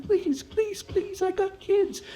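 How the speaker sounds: high, strained begging; small high voice begging for mercy; whispered pleading. Acted. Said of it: "he is whispering".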